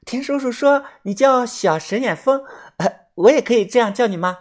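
put-on high, innocent girlish voice; naive young girl. A narrator's reading. Girl asks what to call the narrator, high naive voice. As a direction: pitch it high, guileless and eager. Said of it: "他心思单纯啊， 信了，连连的点头说".

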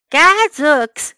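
loud startled exclamation, pitched high; crying out. A narrator's reading. Walrus finds a cat on the table, crying out loudly. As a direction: burst out in alarm. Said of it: "cried the walrus".

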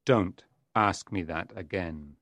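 very low, quiet devil's threat; dark, restrained menace; menacing. A narrator's reading. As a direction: warn him in a deep, softened voice.

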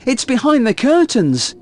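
high-pitched mock alarm; child feigning fright. A narrator's reading. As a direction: play a frightened child telling Dad. Boy fakes fright to dad.

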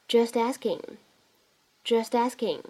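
"just asking" run together as "jus-tasking"; linking. Shown as a demonstration. Teacher repeats for learners, "just asking" linked. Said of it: "我们可以自然地带一个连读".

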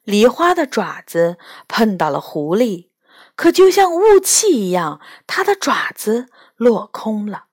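ordinary delivery

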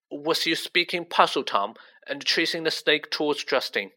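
ordinary delivery, nothing marked

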